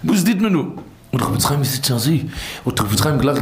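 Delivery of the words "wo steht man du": imitating a distressed, higher-pitched plea; mock-tearful, raised voice. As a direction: plead as if retelling someone's tears. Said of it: "ich hab geweint".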